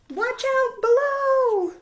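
high, slow, loud warning cry; panicked shout. Acted. Prairie dog yelling a warning to the others, loud and high. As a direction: yell it up high as the ball drops.

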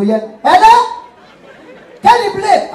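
a poor man calls about a wedding, in a high squeaky mock voice; high squeaky caller. Poor man's voice on phone.

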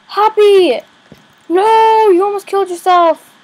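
loud, high alarmed scold; loud frightened scolding; panicked scolding. Real, not acted. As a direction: shout at the bunny in fright. Bunny nearly dies.

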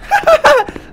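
loud, very high giggle; high laugh. Pretend. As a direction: burst into a high-pitched laugh. Silly laugh after eyes chant.

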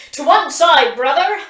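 high, loud battle shout; urgent battle cry; warning shout. A narrator's reading. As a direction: bellow a warning to clear the way. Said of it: "Kintyre is shouting".

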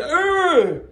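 high disgusted ugh. Retold or imitated. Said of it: "I'm like".

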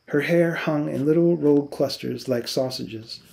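plain reading voice

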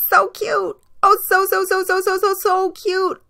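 high squealing, slowing on the last so cute; excited screaming. A narrator's reading. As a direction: squeal it while chasing the puppy. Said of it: "the girl chased him screaming".

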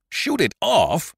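high, slow astonished cry; amazed exclamation. A narrator's reading. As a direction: blurt it out in sheer amazement. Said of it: "exclaimed Doctor Prescott in amazement".

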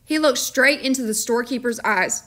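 plain reading voice

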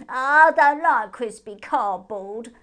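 slow, loud grossed-out hare complaint; disgusted hare. Acted. Moon hare complains about the crisps with loud disgust. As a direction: spit the words out in disgust.

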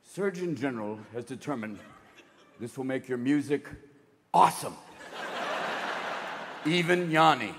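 low, measured warning voice; official warning label. Acted. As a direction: read it out as a deep official warning. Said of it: "It's gonna have to say".

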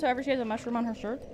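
usual speaking voice

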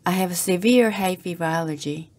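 ordinary talk, nothing marked

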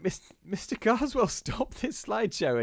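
put-on high pleading kids' cry; frightened children. Children pleading with Carswell to stop the slides. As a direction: beg him to stop it.

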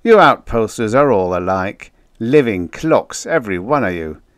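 loud, jovial ribbing; amused teasing. A narrator's reading. Joker teasing outpost men as living clocks, amused. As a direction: rib him loudly, easing down at the end. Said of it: "Town chuckled".

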